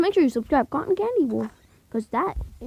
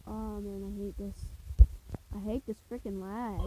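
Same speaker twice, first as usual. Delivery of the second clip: usual speaking voice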